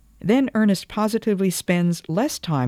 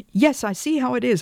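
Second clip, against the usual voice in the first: high-pitched, impassioned retort; passionate outcry; 10 semitones higher than usual. A narrator's reading. Wife lashing out at husband after his hint, passionate outcry. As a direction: cry it out, pitch leaping up. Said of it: "I cried passionately".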